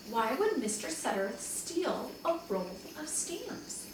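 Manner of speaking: usual speaking voice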